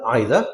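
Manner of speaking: EITHER as "EYE-ther"; British pronunciation. Shown as a demonstration. Teacher recaps both forms, EYE pronunciation. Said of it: "British English, it is".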